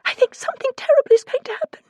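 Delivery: high, fast, tearful dread; sniffling fear. A narrator's reading. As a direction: voice of a frightened friend on the phone. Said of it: "she sniffed".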